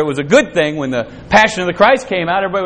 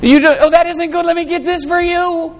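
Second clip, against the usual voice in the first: doting parent mimicry; 8 semitones higher than usual.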